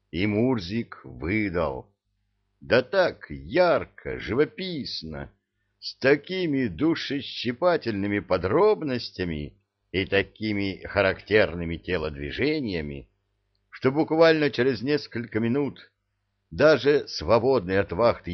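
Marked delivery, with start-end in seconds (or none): none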